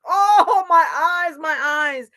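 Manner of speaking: high, loud wail of pain; pained cry. A narrator's reading. Wolf's eyes burnt by fire, wailing. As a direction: cry out in acted agony.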